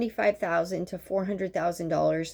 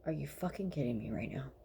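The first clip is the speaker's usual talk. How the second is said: fast, voiceless whisper; whispered disbelief; 5 semitones lower, 12 dB quieter, faster than usual. Retold or imitated. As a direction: hiss it under your breath so the patient can't hear. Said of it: "And I was just like".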